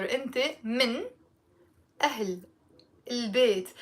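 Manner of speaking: each word set apart with pauses; slow, word by word. Shown as a demonstration. Teacher slowing the phrase down for learners, slow. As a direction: say it slowly, one word at a time. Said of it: "Let me say it slowly".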